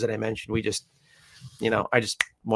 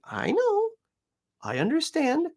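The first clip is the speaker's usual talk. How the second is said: gentle reassurance to puppy. Retold or imitated. Owner soothes growling puppy.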